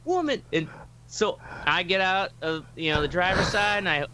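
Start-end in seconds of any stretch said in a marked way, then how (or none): 0.0-0.4 high put-on voice of a man; imitating Derek. Derek snapping at Jasmine in a high mock voice. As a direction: squeak it out as him. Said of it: "he's like".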